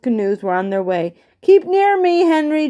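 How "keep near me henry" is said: high-pitched call across distance; calling back. A narrator's reading. Canoeist to the boy behind, calling back. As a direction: call back to the other boat, pitch well up. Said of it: "Joe called back".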